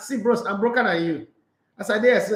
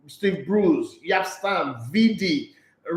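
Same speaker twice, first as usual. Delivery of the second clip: ordinary talk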